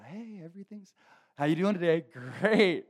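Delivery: high, over-bright mock greeting; fake cheer. Churchgoer greets brothers in the faith, fake cheer. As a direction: put on a hammed-up happy front. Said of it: "we put on a front".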